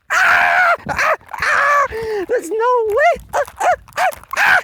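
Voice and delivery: very high loud cackling; mocking shriek-laugh. Laughing at Reina's obsession, high loud cackle.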